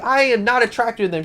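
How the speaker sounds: high-pitched, exasperated denial; indignant, voice lifted high; emphatic denial. Retold or imitated. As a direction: protest in mock indignation. Duke's acted denial.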